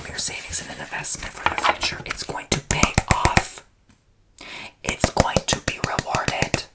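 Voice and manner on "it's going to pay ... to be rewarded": slow, loud forceful whisper; whisper shouting. Real, not acted. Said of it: "I love whisper shouting".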